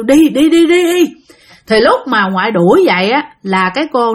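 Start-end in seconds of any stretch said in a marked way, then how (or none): 0.0-1.0 higher, louder repeated shooing; shooing cry. Retold or imitated. Grandmother waving a branch to drive out the ghost, urgent shooing. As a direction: imitate chasing something out. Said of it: "ngoại kêu".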